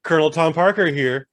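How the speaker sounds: loud, hammed-up Colonel butting in; Colonel Tom Parker. Colonel breaks into his own movie, hammed-up announcing. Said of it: "the movie will just sidestep, like".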